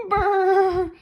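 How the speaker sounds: high, loud brrr shiver. A narrator's reading. Jelly shivers.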